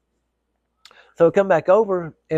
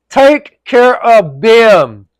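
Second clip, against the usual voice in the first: loud, slow, pitched-up angry bark; angry shout; 8 semitones higher, 12 dB louder, much slower than usual. Retold or imitated. Shouting at the cashier to serve the lady first, angrily. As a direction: slam the words down, fed up. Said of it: "the way I said it, he could tell I was pissed off".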